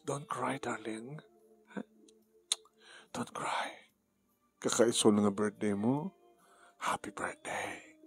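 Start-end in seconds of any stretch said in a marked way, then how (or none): 0.1-3.9 hushed, tender comfort fading to a whisper; quiet him gently like a lover; soothing whisper. Acted. Gina hushing crying Daniel on his birthday. 6.8-8.0 slow, voiceless birthday wish; slow whisper. Acted. Lover whispering happy birthday to Daniel.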